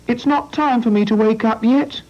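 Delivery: very high, small sleepy voice; a drowsy little creature peeping up; tiny voice. Acted. Hedgehog still curled up, grumbling in a very high voice. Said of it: "said a tiny voice".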